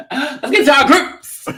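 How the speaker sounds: high, drawn-out playful holler with laughter; loud hammed-up announcement breaking into laughs; hyped-up shout. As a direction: holler the segment intro, stretching it out. Hyped shouted transition to next segment.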